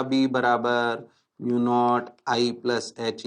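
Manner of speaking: low, unhurried dictation; walk them slowly through it for memorising; slow recital. Real, not acted. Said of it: "धीरे धीरे बोल रहा हूं".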